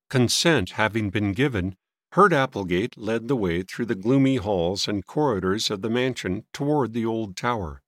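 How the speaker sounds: plain reading voice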